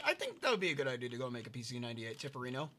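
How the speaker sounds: normal talking voice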